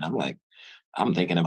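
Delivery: ordinary talk, nothing marked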